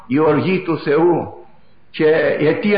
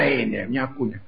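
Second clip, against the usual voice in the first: soft echo; 5 semitones lower, 6 dB quieter than usual. Shown as a demonstration. Preacher repeating that the earth is a cradle, softly echoed. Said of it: "επαναλαμβάνω".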